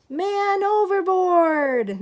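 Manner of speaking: high, loud drawn-out cry; shouted alarm. Acted. Sailors spotting George in the water, crying out loud. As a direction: yell it across the ship.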